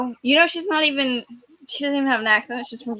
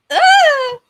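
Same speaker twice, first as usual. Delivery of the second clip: loud high-pitched shriek; high scream; 10 dB louder than usual. Retold or imitated. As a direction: let out a shrill battle cry. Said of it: "Rogue was like".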